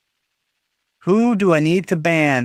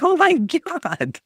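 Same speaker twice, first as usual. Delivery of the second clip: retold dismay; 11 semitones higher, slower than usual. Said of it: "And I'm like".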